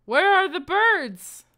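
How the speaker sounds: high, loud, drawn-out demand; indignant cry. Real, not acted. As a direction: cry out slowly, wanting the missing birds back. Indignantly demanding the missing app mascot.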